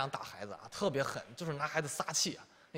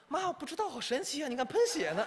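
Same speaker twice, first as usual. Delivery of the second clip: put-on naive little kid, much higher pitch; high childlike voice; 10 semitones higher than usual. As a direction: play the innocent little boy. 孩子天真回答妈妈. (天真的小孩高声说)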